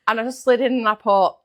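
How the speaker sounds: ordinary delivery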